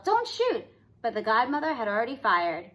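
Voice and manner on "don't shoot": high frightened cry; yell. A narrator's reading. Cindy begging the godmother not to fire, high yell. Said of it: "Cindy yelled".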